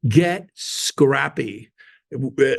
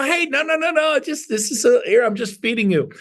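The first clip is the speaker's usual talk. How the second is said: high humble protest; 9 semitones higher than usual. Retold or imitated.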